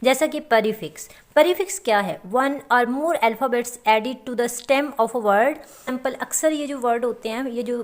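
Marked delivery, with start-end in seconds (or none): 2.3-5.5 slow dictation. Shown as a demonstration. Dictating a definition slowly.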